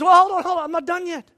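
high-pitched "hold on" interruption; interjecting. Acted. God interrupts the celebration.

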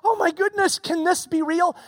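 can't believe the gift is theirs; amazed shepherds. Pretend. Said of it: "They were starting like".

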